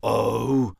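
loud scornful snort. Acted. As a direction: snort the word out. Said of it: "snorted Frog".

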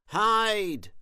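high, loud drawn-out warning cry. A narrator's reading. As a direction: shout the warning high and long. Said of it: "he cried".

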